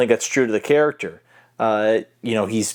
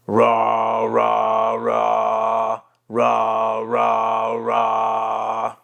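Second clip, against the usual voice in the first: long, sustained throaty "rah"; vibrating throaty "rah"; much slower than usual. Shown as a demonstration. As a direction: hold each rah on one note, vibrating the vocal cords. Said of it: "you're vibrating those vocal cords".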